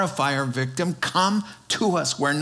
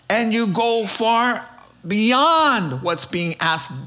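ordinary talk

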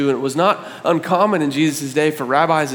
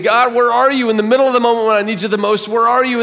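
higher, louder, fast pleading; urgent cry to God; 8 semitones higher, 4 dB louder, faster than usual. Acted. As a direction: demand an answer from God. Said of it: "David is crying out to God".

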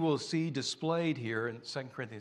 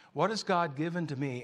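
normal talking voice

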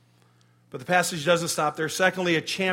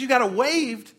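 higher and louder, amazed; surprised; 6 semitones higher, 6 dB louder than usual. Retold or imitated. Retelling his surprise at the waived ticket, amazed. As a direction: blurt out astonished disbelief. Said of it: "I said".

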